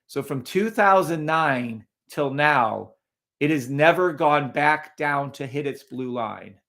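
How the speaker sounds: ordinary talk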